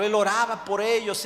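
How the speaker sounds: ordinary talk, nothing marked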